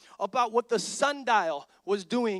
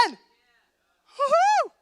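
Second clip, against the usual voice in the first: a sudden high whoop of mock celebration; mock cheer; 8 dB louder than usual. Preacher mocking the rat race with a high sarcastic whoop. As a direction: throw in a sarcastic woo-hoo.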